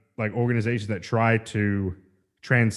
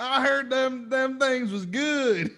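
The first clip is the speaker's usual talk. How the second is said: put-on over-the-top American accent; slower than usual. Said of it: "pull out that American accent as hard as you can".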